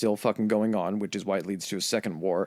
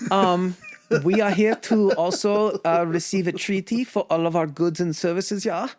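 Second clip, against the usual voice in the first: hammed-up Swedish accent pitching furniture; mock Swedish accent; 11 semitones higher, 5 dB louder than usual. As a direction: ham up a cheerful Swedish envoy. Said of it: "the Swedish and the Norwegians show up".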